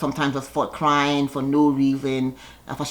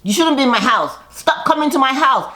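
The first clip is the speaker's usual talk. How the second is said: retold telling-off; 8 semitones higher, 3 dB louder than usual. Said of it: "Candace says".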